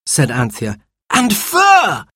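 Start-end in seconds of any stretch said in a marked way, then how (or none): 1.1-2.1 put-on high excited child shout. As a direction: blurt it out in thrilled surprise. Discovering fur in hole.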